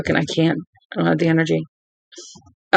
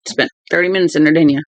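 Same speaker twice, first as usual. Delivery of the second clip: ordinary talk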